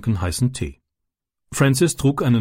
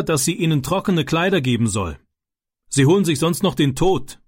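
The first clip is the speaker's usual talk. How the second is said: raised, high-pitched voice for urgent orders; calling out orders; 6 semitones higher than usual. A narrator's reading. Angry man sending the wet boys home, calling out orders. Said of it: "rief sie mit lauter Stimme zurück".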